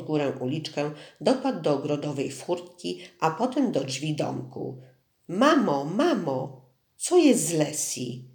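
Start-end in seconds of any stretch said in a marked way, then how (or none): 5.3-8.2 put-on boy calls out to his mother, anxious; calling out. A narrator's reading. Boy bursting in calling his mother, urgent call. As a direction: shout it from the doorway, worried. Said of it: "zawołał".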